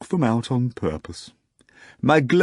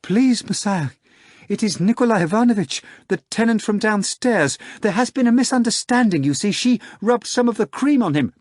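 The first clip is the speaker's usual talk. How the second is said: much higher, fast, urgent pleading; pleading Margarita; 13 semitones higher, 4 dB louder than usual. A narrator's reading. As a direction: rush the plea out, begging. Margarita pleads for Nikolai Ivanovitch.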